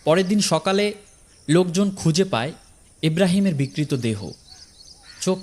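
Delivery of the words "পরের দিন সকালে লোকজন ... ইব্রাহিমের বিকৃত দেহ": ordinary delivery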